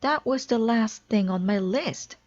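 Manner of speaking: happily remember the last item; eager high announcement; excited. A narrator's reading. Toad's excited outburst continues.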